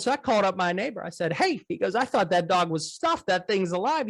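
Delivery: put-on excited old farmer recounting his dog; dairy farmer. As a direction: play the farmer, voice lifted high. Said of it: "So he goes".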